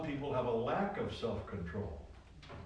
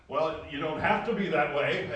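normal talking voice

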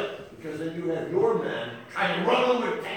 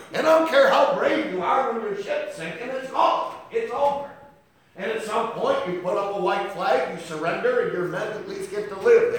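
loud, higher, driving sermon cry; a full-throated preacher's build; preaching at full force; 6 semitones higher, 8 dB louder than usual. Acted. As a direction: thunder it out from the pulpit.

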